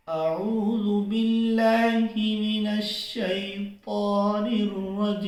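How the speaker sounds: slow, drawn-out chanted recitation; Quranic recitation. Shown as a demonstration. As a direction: recite it melodically with long held vowels. Recites with tajweed.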